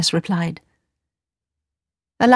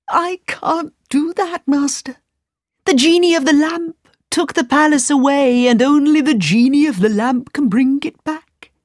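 genie; 7 semitones higher than usual. Acted. Genie answers Aladdin.

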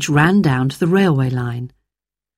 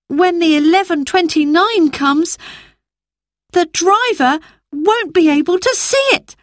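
put-on much higher boy's voice, grave; a boy warning of disaster; worried boy Peter; 12 semitones higher than usual.

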